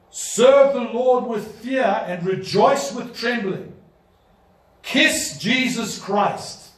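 slow, loud solemn warning; preaching proclamation. Acted. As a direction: thunder a warning to the rulers.

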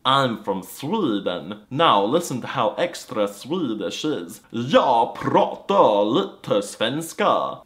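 put-on slow, drawn-out Swedish accent. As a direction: stretch every vowel the Swedish way. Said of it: "engage my Swedish accent".

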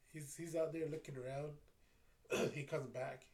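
ordinary talk

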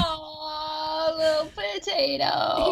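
high cooing over a pet. Real, not acted. Cooing over fat cat.

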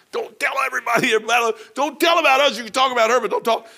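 high, loud, panicky pleading; panicked townsmen. Retold or imitated. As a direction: beg them not to tell, half shouting. Guilty townsmen begging secrecy.

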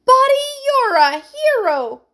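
high, loud, gushing; excited cry. A narrator's reading. Heidi to Buddy as he saves them, crying out. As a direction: cry out in admiration. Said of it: "cried Heidi".

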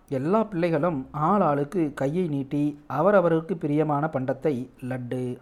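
ordinary delivery

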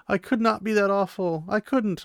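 high pleading protest; Elaine pleading. Retold or imitated. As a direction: plead your innocence, pitched high. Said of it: "she's like".

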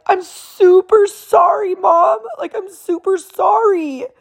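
put-on very high, drawn-out little-boy wail; imitated tearful child. Youngest son apologizing to mom through tears, imitated high wail. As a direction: mimic a sensitive kid crying out an apology. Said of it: "he starts crying".

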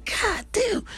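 high, disbelieving God damn; shocked exclaim. Retold or imitated. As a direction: squeal it out in disbelief. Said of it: "I said".

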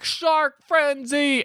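high, very slow dramatic announcement; hammed-up battle cry. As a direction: drag it out like a cheesy superhero move. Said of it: "And he's like".

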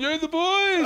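very high drawn-out shout; comic re-enacted triumphant call; hollering back. Cuffed inmate calls to his mates, hollering.

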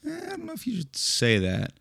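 high, quiet reluctant "eh"; mock-wary, softly voiced disapproval; hesitant objector. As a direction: imitate someone meekly objecting. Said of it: "will be like".

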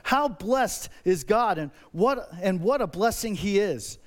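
ordinary delivery

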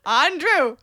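loud mock-Scottish call; badly faked Scottish; Scottish accent. Shown as a demonstration. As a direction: belt it in an attempted Scottish accent. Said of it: "Wait, do it again".

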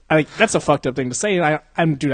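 ordinary talk, nothing marked

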